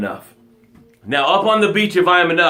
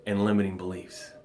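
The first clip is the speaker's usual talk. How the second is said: fading out; 9 semitones lower, 6 dB quieter than usual. Pretend. Drowning in doubt, fading.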